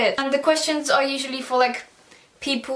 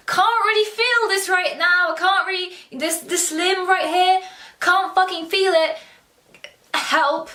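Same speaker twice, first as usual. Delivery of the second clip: higher-pitched, mock panicked plea; retold panic; 5 semitones higher than usual. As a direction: act out panicking to mum, voice raised. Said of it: "panicking high key".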